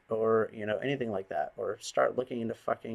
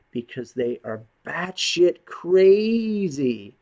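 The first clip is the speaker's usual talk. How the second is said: emphatic; 7 semitones higher, 9 dB louder, much slower than usual. Real, not acted. Ranting emphasis.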